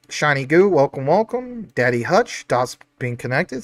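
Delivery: ordinary talk, nothing marked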